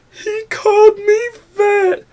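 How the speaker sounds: very high, slow fake wail; hammed-up crying voice, loud and drawn out; mock someone sobbing over an insult; mock crybaby. Mocking someone hurt at being insulted.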